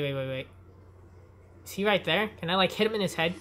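ordinary delivery

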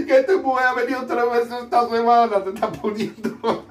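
very high, squealing through tears of laughter; barely getting the punchline out through laughter; laughing so hard. Real, not acted. Crying with laughter while retelling the joke to María. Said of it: "Madre mía".

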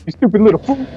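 high, put-on tough-guy taunt; Mister T. Repeating the taunt to a teammate, mock Mister T. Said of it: "What am I, Mister T".

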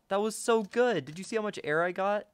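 high-pitched, worked-up gamer; worked-up protest. Real, not acted. Protests after a failed jump.

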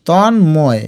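Tanmoy as drawn-out ton, then moy; broken in two. Shown as a demonstration. Teacher repeats it for spelling, Tanmoy in two parts. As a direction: pause between the halves of Tanmoy. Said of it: "ভেঙে ভেঙে লিখবে".

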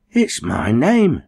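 Cherry reading his win, slow amazement; stunned. A narrator's reading. Stunned at lottery letter.

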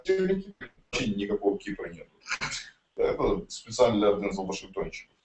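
ordinary talk

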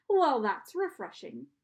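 slow, loud British-sounding ferryman; strange British accent. A narrator's reading. Charon answers the children's request, strange British accent. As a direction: drawl it in an odd British accent. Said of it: "He had a strange accent".

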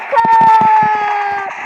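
sudden loud yell to run; shouted warning. Real, not acted. Gamer yelling at teammates to run, loud shout. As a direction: bark the order to flee.

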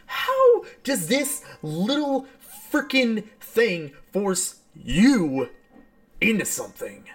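ordinary delivery

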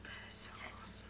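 barely audible whisper, very quick; awed whisper. Real, not acted. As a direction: whisper it under your breath, overwhelmed. Moved by birthday card inside.

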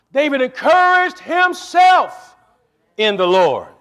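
rising high and loud on himself; preaching climax. Acted. Preacher telling the church how David found strength. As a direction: lift it up like a preacher's peak.

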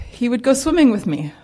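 ordinary talk

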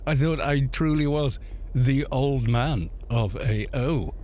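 ordinary talk

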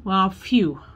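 usual speaking voice

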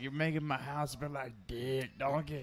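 put-on cartoon ogre voice; lightly hammed-up ogre impression; Shrek. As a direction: imitate an ogre complaining to his sidekick. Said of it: "He's like".

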